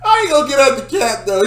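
high, loud, fast hammed-up dad; a hint of a riled-up father; mock angry dad. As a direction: imitate the dad, cranked up for laughs.

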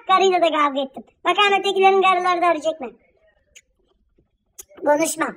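ordinary delivery

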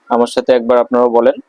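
ordinary talk, nothing marked

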